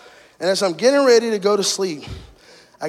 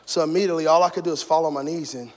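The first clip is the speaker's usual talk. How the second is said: ordinary talk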